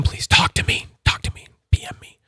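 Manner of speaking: urgent playful whisper; whispered plea. Pretend. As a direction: whisper it like a secret appeal. Mock-secret plea to sellers.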